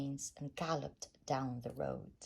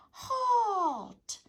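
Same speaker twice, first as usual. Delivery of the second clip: a big high bellow, much louder; bellowed halt; 8 dB louder than usual. A narrator's reading. Highway rat halting travellers with a deafening bellow. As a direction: bellow it to stop everyone. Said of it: "who bellowed a deafening".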